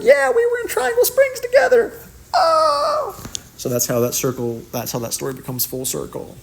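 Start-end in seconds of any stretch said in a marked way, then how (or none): 0.0-3.2 excited reply. Retold or imitated. Retold own reply, high.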